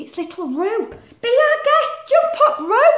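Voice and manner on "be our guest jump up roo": very high and loud, eager; welcoming character voice. Acted. Animals inviting Roo into the digger, high and loud. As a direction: cheerfully invite him aboard.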